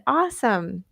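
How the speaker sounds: normal talking voice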